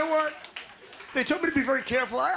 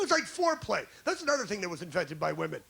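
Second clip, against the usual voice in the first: ordinary talk, nothing marked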